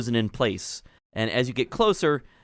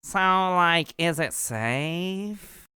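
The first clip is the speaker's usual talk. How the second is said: small nervous muffled voice behind a door; muffled gnome voice; 5 semitones higher, much slower than usual. Acted. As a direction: speak through a closed door, scared. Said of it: "a muffled voice says".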